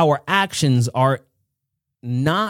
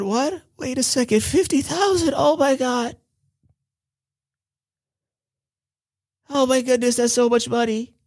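higher, startled voice; shocked disbelief; 7 semitones higher than usual. Acted. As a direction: balk at the price. Kim shocked by the price.

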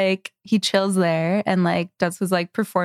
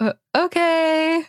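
awkward okay; 9 semitones higher, slower than usual. Retold or imitated. Hesitant reply to odd stranger.

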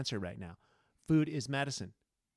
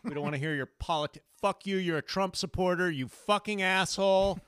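louder snapped mock retort; mock angry critic; 4 semitones higher, 6 dB louder than usual. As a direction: shout him down as a critic. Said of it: "angry critics reply".

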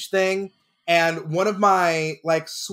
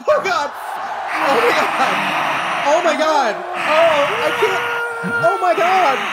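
high, loud mock-ecstatic screaming; hammed-up winner's shock; 13 semitones higher, 4 dB louder, slower than usual. Pretend winner hearing his name announced, screaming overjoyed. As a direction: shriek in overacted disbelief.

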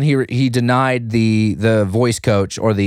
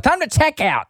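guard quote. Retold or imitated.

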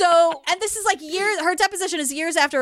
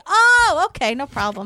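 mock carefree acceptance. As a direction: brush it off with comic nonchalance. Acting an absurdly untroubled listener.

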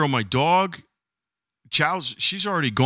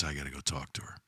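grim quiet chief; 12 semitones lower, 17 dB quieter, faster than usual. Retold or imitated. Chief of police, low grim.

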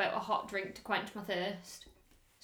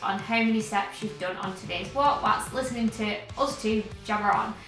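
louder upbeat podcast promo read; 5 dB louder than usual. Acted. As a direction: sell it like a sponsor spot. Reads podcast outro ad over music.

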